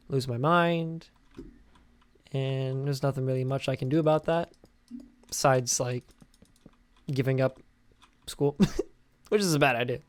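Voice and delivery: ordinary talk, nothing marked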